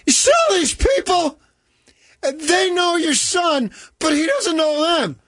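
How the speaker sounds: very high shouted imitation of mother; mother's scream. Mother tells father about fans across the room, imitated screaming. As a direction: shout each phrase across a crowded room. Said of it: "screams across the opposite end".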